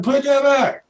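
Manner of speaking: high mock yell of outrage; retold yell. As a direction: shout it high and indignant. Yelling at Spurrier over putter.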